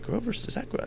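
high, soft startled question; surprised recognition. Retold or imitated. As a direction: spot an old friend, half-believing.

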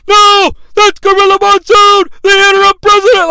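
high, loud anguished shout; Vince impression shout. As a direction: cry out in protest. Vince call again.

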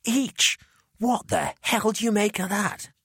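bursting with glee over the money; Jamie, excited. Acted. Jamie to Clive, thrilled about the payment.

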